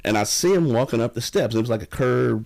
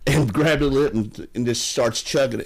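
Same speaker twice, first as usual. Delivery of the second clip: ordinary delivery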